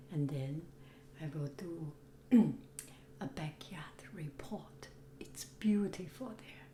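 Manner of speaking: soft whisper, voice gone; raspy whisper. Real, not acted. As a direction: whisper it, hoarse and hushed. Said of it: "I have no voice".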